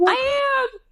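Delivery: high shrieked answer; hammed-up shriek while on the phone; retold shriek. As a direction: squeal it way up high. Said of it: "as I'm shrieking to my mom".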